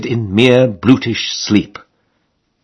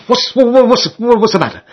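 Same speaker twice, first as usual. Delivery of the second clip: startled awake; 12 semitones higher than usual. Acted. George yanked from sleep by his friends, stammering in high alarm. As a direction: splutter it out, pitched up and flustered.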